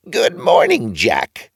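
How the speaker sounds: very high squeaky greeting; funny old man. A narrator's reading. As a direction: greet him like an odd little old man.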